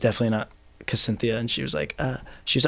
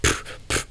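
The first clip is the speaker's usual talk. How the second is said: breathy puffing sounds; 7 dB quieter than usual. Retold or imitated. Imitating girl's dance sounds.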